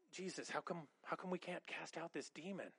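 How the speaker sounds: quietly pleading like a confused follower; softly voiced puzzled disciples. Disciples softly asking Jesus why they failed, plaintive. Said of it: "they go to Jesus and they go".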